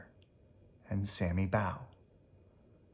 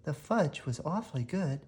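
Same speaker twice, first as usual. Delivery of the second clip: softer, contented voice; pleased mother; 2 semitones higher, 8 dB quieter than usual. Acted. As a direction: savor it quietly. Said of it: "Mama Witch said".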